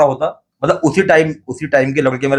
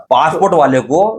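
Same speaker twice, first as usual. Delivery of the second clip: normal talking voice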